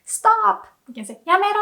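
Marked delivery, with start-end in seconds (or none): 0.1-0.7 sharp high shout of stop; rude stop. Shown as a demonstration. Teacher shows rude command. 1.2-1.6 sharp, high, rude やめろ; rude command. Shown as a demonstration. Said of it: "the more rude way".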